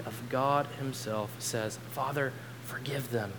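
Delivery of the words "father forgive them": soft, restrained prayer; hushed plea. A narrator's reading. Jesus prays for his executioners, in a hushed plea. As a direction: drop the voice right down for the plea. Quotes Jesus praying with authority.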